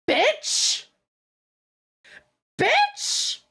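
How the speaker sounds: rising high-pitched outraged cry; mock outraged yell. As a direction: shriek it in disbelief. Exasperated outburst retold.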